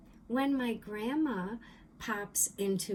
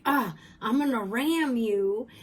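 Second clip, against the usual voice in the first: loud burst of mom's mock threat; mom's intonation; 2 semitones higher, 9 dB louder than usual. Thought pops in while frustrated at work, mom's loud mock threat. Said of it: "but with the intonation of my mom".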